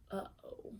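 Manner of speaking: breathy ominous whisper. Acted. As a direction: whisper it like trouble ahead. Narrator aside whisper.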